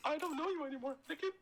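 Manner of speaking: high breathy falsetto Padme, swooning; hammed-up high Padme voice. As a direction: squeal her name in a high falsetto. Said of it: "my Padme is unmatched".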